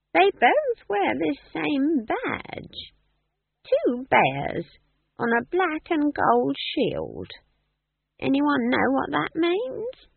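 put-on high, slow cackly old woman; old witch voice. Nanny Ogg voiced.

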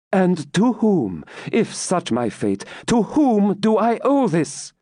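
high, impassioned demand; Rebecca, indignant. Acted. Rebecca asking the Templar who condemned her, indignant high voice. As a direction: press the question, leaning on each "to whom".

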